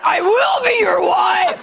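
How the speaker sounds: shouting the yes at full volume, slowly; retold yell. Retelling the proposal, yelling yes to her fiancé with her mouth full. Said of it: "I'm like".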